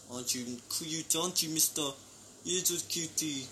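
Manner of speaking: soft cooing to a pet. Real, not acted. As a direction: coo at the little lizard. Talking to pet lizard.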